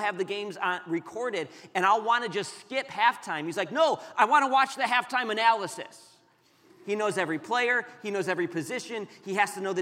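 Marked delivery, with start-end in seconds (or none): 3.7-6.2 higher, louder mock protest; insistent young son. Son refusing to let dad skip halftime, loudly insistent. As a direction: imitate a kid firmly objecting.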